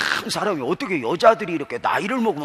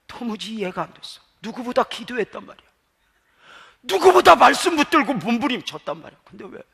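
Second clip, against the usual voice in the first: rising to a full-force cry of protest; sermon cry of why, building louder and higher; anguished outcry; 8 semitones higher, 7 dB louder than usual. Real, not acted. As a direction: pour out the bewilderment, building to a loud far-carrying peak.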